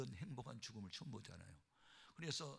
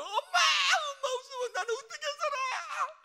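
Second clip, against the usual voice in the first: very high, loud bawling wail; wailing child; 18 dB louder than usual. Retold or imitated. Youngest son begging dying mother not to die, wailing. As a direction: howl it like a child losing a parent. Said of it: "애가 막 통곡을 하는 거야".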